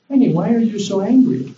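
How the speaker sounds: high, coaxing question to a partner; concerned spouse. Retold or imitated. Spouse home from work to upset partner, concerned coaxing. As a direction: gently press your upset partner to open up. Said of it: "your wife's upset or your husband's upset".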